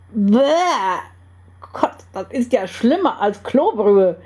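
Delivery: revolted exclamation after a bad sip; disgusted. A narrator's reading. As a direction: spit out the verdict in disgust. Tastes awful coffee in the story.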